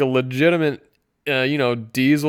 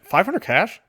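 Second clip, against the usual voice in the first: higher mock disbelief on "Five hundred cash?"; incredulous; 7 semitones higher than usual. Reviewer repeating the price in mock disbelief. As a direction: echo "Five hundred cash?" as if it's absurd.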